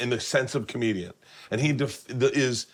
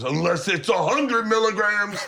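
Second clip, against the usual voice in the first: hammed-up taunt, pitched up; mock gleeful reveal; 8 semitones higher, 3 dB louder than usual. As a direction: imitate a prankster crowing the catch. Acts Joey's gleeful reveal.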